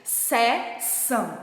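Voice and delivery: slow drawn-out seção for pupils to repeat; slow teacher demo of seção; model word for class. Shown as a demonstration. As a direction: say each syllable slowly, se-ção. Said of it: "Falem essa seção de cima com a tia".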